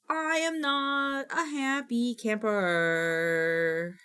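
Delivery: very slow, stretched-out mock sulk; drawn-out sulk. Repeating her complaint to viewers, a slow exaggerated sulk. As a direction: drag every word out like a pouting kid.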